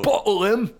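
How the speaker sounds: loud, high working-class Brit; rough British accent. Retold or imitated. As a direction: shout it like a rowdy English lad. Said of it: "the low class ones would be like".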